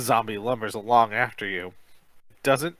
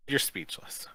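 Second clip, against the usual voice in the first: plain reading voice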